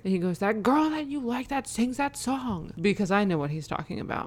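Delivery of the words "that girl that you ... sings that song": put-on higher, slow whiny kid voice; imitating insistent son. Four-year-old pressing mom for a singer's name, insistent kid imitation. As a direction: stretch it out like an exasperated small child. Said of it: "then he gets super frustrated".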